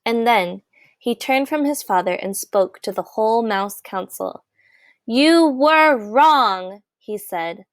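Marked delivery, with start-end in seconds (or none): 5.1-6.8 very slow, higher, louder words; firm accusation. A narrator's reading. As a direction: lay each word down before the council. Despereaux confronts the council.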